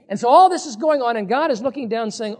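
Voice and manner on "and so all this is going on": ordinary talk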